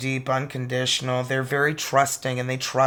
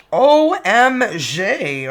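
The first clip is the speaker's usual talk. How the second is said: excited exclamation; 7 semitones higher, 9 dB louder, much slower than usual. Real, not acted. Tarot reader reacting to a card.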